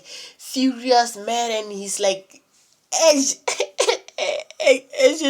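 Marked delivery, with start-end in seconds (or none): 2.9-5.3 laughing. Real, not acted. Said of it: "laughing at his own word".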